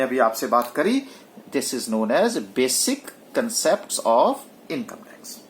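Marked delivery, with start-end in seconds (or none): 1.6-5.4 very slow, lower, word by word; slow dictation. Shown as a demonstration. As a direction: read the title out slowly for notes. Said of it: "teacher dictates heading".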